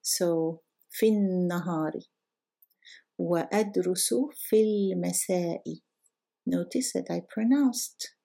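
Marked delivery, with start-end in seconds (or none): 0.9-1.9 في النهار with a long held n; double nun. Shown as a demonstration. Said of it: "double nun means". 4.3-5.6 في المساء as fil-masa with a clear l; pronounced lam. Shown as a demonstration. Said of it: "I did pronounce the lam".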